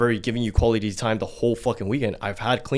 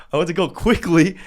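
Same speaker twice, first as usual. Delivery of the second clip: laughing through the words, louder and higher; 8 semitones higher, 6 dB louder than usual. Real, not acted. Telling his friend about the spill, laughing. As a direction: giggle out the frantic scramble. Laughing while retelling the frantic cleanup.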